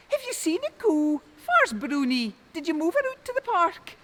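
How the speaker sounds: high, loud, worried calling; wife panicking over the lost cow; alarmed wife. A narrator's reading. Wife asks husband and son, high and alarmed.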